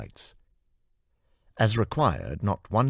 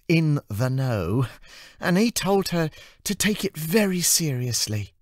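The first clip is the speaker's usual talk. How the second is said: put-on much higher, chirpy young woman's voice; bubbly and earnest with a warning; cheerful assistant; 13 semitones higher, 6 dB louder than usual. Amy warning Anna about the curfew, in a high cheerful voice.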